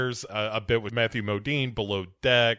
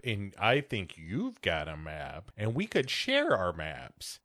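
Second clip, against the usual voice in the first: a low, slow seductive voice; low, slow whisper-like flirt; 6 semitones lower, 7 dB quieter, slower than usual. Acted. Geena Davis voice, low.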